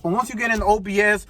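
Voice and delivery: ordinary delivery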